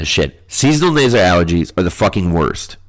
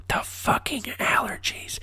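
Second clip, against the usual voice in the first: hissed, voiceless whisper; hushed stage-whisper rant; exasperated whisper; 13 dB quieter than usual. Pretend. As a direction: whisper it through your exasperation. Repeats the phrase in exasperated whisper.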